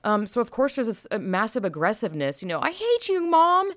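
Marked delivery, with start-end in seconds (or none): 2.6-3.7 put-on high, whiny teenager yelling at mom; bratty teen. Mocking an angsty teen yelling I hate you at mom. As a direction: mimic a sulky kid screaming at her mother.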